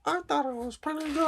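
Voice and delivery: high goofy dog voice. Pretend. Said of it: "[name] doing that Kringay dog voice".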